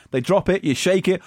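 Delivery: usual speaking voice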